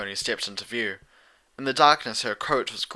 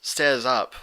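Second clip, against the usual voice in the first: ordinary delivery, nothing marked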